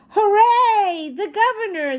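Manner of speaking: high, loud cheer of delight; children cheering at happy news; excited cheer. A narrator's reading. Children cheering the governor's coming, high and loud. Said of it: "the children cried".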